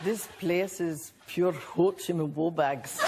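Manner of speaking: put-on a light Scottish accent, slow and half-laughing. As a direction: try a Scottish accent on request, slowly and giggling. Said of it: "do a bit".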